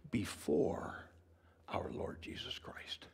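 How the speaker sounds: soft unvoiced whisper of reverence; hushed whisper. Shown as a demonstration. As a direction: whisper Before our Lord Jesus Christ. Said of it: "the next preposition ties it up".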